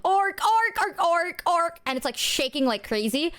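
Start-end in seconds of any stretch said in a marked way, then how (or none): 0.0-1.8 high grunting orc orc noises; hammed-up monster grunting; mock orc grunts. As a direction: imitate a friend's silly orc chant. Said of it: "Mono going".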